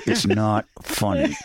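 slow, gritted-teeth mutter, not funny; fuming through teeth. Retold or imitated. Furious friend mutters at the table, slow and gritted. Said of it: "He's like".

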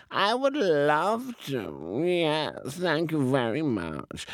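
slow, high cat voice; a delighted cat accepting the invitation, slow and a little high. Acted.

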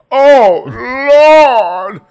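wailing very high and very loud; a panicked passenger shrieking to the Lord; wailing woman. Retold or imitated. Passenger on a landing plane wailing very high and loud. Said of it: "And she is".

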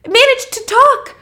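high, loud gushing; fangirling. Retold or imitated. Robert raves about Jacques and his android, high-pitched fangirling. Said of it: "Robert is fangirling at this point".

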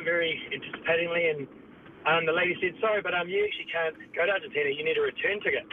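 usual speaking voice

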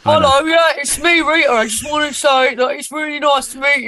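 real Rita lightly parodying her own impression; playful send-up of the fake Rita voice; mock [name] impression. Rita jokingly imitates the host's impression of her. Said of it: "That was a really good impression of me".

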